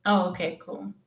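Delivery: ordinary talk, nothing marked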